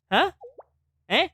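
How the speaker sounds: usual speaking voice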